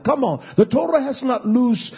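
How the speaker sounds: ordinary talk, nothing marked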